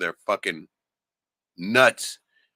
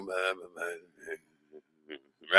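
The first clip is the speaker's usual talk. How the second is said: bored blah blah; 5 semitones lower, 4 dB quieter, much slower than usual. Retold or imitated. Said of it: "It almost sounds bored".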